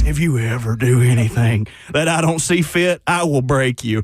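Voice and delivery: low, menacing biker dad. Retold or imitated. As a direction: growl the warning low. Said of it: "gave me one of those talks".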